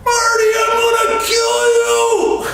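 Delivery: very high, loud mock scream. Friend burning from Bengay yelling at Marty, high mock scream. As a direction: scream the threat from the bathroom. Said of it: "you could hear this guy screaming".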